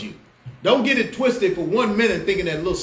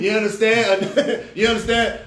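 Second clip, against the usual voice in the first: laughing; 4 semitones higher, 3 dB louder than usual. Real, not acted. Laughs at Dylan Roof name.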